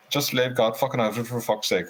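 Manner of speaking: retold Cork-accented plea. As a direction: imitate a Cork man giving advice. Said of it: "he said, in his Cork accent".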